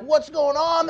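high, agitated question; stirred-up cry. Acted. Townsperson as Jesus enters Jerusalem, stirred-up cry. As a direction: call out like someone caught in a commotion. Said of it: "the whole city stirred up".

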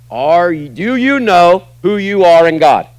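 normal talking voice

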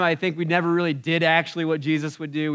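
usual speaking voice

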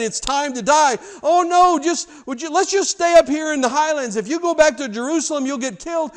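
pleading that climbs to a high, loud cry; escalating pleading argument. Acted. Peter begs Jesus to stay in the highlands, high and loud.

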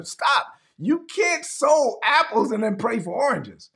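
ordinary delivery

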